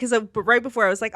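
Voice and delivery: usual speaking voice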